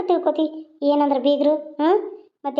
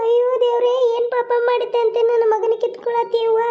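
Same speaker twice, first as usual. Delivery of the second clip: wailing; 5 semitones higher than usual. Acted. Mother grieving for her injured son, wailing. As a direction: wail aloud for your child. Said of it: "ಅಳ್ಬೇಡ್ಲಿ".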